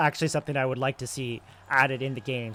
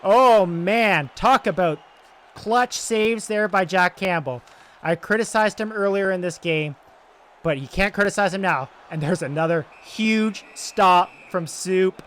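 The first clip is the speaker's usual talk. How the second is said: excited; 6 semitones higher, 9 dB louder than usual. Real, not acted. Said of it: "I'm getting so excited about this".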